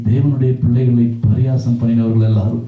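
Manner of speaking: normal talking voice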